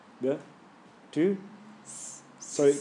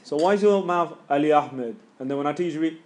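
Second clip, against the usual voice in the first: ordinary talk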